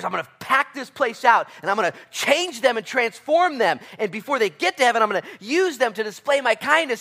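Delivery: high, loud triumphant repeat; loud proclamation. Acted. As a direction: declare it at full force. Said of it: "Oh, I'm gonna pack this place out".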